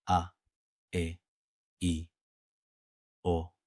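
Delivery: usual speaking voice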